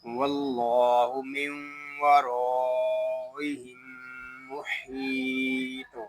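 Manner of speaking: slow recitation, stopping on Muhit; slow tajweed recitation ending on a stop; Quran recitation with pause. Shown as a demonstration. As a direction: recite it, stopping on the last word. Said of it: "अब हम वक्फ में इसको ऐसे पढ़ेंगे".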